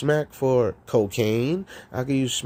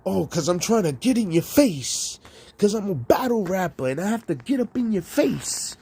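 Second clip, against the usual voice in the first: higher, taunting battle rapper voice; mocking battle rapper; 6 semitones higher than usual. Rapper mimics a battle rapper explaining why he gets close, mock taunting. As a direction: play the in-your-face battle rapper, lightly mocked.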